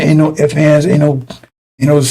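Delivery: usual speaking voice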